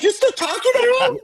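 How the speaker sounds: very high-pitched mock wife; exaggerated high-voiced spousal challenge; mock incredulous wife. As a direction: squeak it out as an exasperated wife. Wife challenging him, acted out.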